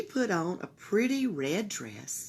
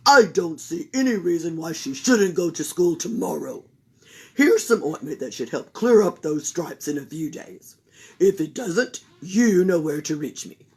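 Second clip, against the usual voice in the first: loud pompous doctor; Doctor Bumble pronouncing; Doctor Bumble concluding; 4 semitones lower, 6 dB louder than usual. Acted.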